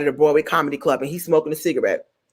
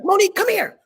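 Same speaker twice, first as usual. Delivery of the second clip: pitched way up, summoning; high bossy diva; 12 semitones higher than usual. Retold or imitated. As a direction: call her up like a diva. Director voiced high.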